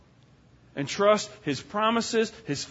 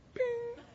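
pop; 11 dB quieter than usual. Retold or imitated. First sprout pops up.

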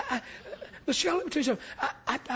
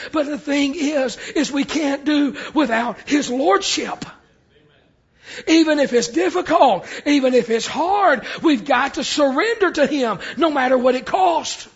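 louder, slowing to a shouted climax; full-force preaching; 7 dB louder than usual. Acted. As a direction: preach it hard, building to a shout.

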